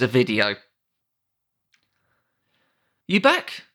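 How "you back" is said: bright high question. Acted. Narrator asks reader after break.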